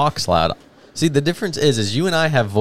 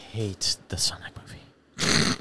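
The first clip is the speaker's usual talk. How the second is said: slow, whispered declaration; very soft, drawn-out whisper; hushed emphasis; 2 semitones lower, 12 dB quieter, much slower than usual. Real, not acted. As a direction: whisper it slowly for effect. Mock-solemn declaration of hatred.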